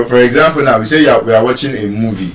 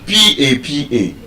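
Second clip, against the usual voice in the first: ordinary talk, nothing marked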